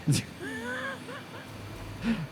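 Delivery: long, very high-pitched laugh. Real, not acted. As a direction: squeal with laughter, trailing softly. Laughing after a baffled reply.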